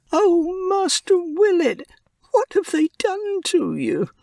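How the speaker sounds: a little mouse distraught over his dying friend; young mouse Pip. A narrator's reading. Pip grieving over Willet.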